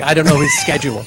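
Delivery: normal talking voice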